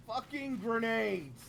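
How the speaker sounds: loud, slow gleeful yell; excited shout. Real, not acted. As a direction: belt it out like the obvious punchline. Gleeful answer about playing kobolds.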